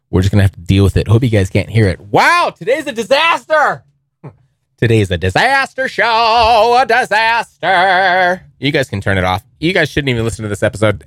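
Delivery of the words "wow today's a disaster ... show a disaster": high, drawn-out mock despair; mock disaster lament. Host bemoaning noisy furnace on his own podcast, mock despair. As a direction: ham up the despair, stretching each word.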